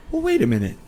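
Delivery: high-pitched indignant sleuth; hammed-up whodunit line; mock murder-mystery protest. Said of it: "I was gonna be all".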